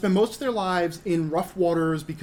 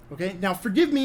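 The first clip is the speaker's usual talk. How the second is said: ordinary talk, nothing marked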